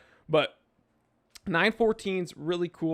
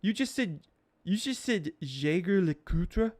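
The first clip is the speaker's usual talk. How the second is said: appalled snob; 3 semitones higher than usual. Acted. Swiss-French snob challenging an American's pronunciation, appalled and slow. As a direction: play a French snob quoting the mistake back in disbelief. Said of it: "Some Swiss, French snobs will be like".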